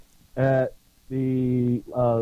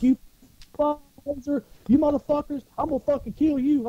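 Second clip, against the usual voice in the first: retold screaming rage; 12 semitones higher than usual. Marine threatens a poser while being held back, mock screaming. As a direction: act out a furious tirade without full volume. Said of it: "I'm screaming, I'm cussing".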